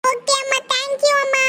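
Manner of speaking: put-on chirpy kid who just got his way; excited thank you; delighted thanks. Son thrilled at permission.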